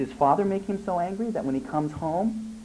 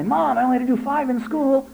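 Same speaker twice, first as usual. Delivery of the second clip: put-on much higher, louder whiny kid voice; whiny child; 12 semitones higher, 5 dB louder than usual. Son pleading with mom to do fewer, whiny. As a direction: play the kid bargaining, pitched way up.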